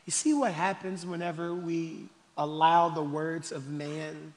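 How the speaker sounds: normal talking voice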